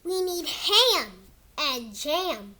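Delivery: slow, sing-song storybook read; reading to kids. Acted. Kid reading his book to viewers in storybook voice. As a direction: read it like a bedtime book for little ones. Reads picture book aloud.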